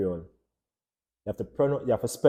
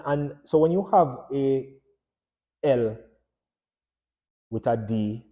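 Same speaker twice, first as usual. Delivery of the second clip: ordinary delivery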